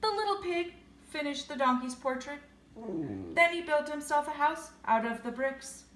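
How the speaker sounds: ordinary delivery, nothing marked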